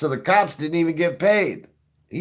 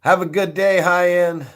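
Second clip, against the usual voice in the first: cheery mock farewell; 5 semitones higher, 5 dB louder than usual.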